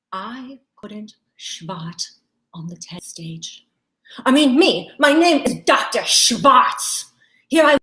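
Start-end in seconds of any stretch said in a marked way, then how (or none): 0.1-3.7 slow, hushed confession; hushed whisper. Acted. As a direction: drop to a whisper.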